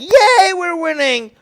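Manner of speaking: high, loud mock celebration; mock cheer. Supporters gloating over early results, high mock cheer. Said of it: "people are gonna be like".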